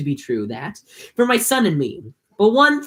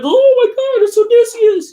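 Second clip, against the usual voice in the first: much higher, loud gushing squeal; squealing Penelope; 7 dB louder than usual. Retold or imitated. Penelope recognizing the robe, squealing much higher. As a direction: squeal it like a starstruck fan. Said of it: "she's like".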